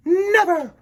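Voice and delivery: high, loud refusal; Wendy refuses to join the pirates, crying out; defiant cry. Acted. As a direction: shout it back in defiance. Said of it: "Wendy cried".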